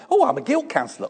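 high, quick, breezy "Oh, I'm a guilt counsellor"; breezy chirpy answer. Retold or imitated. Pastor answers a stranger at the supermarket, high and breezy. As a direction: toss it off brightly and fast. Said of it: "Try that sometime".

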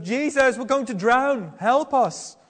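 mock-shouting disciples waking Jesus; shouting over the storm. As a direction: yell to wake him over wind and waves. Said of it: "trying to shout over the roaring of the waves".

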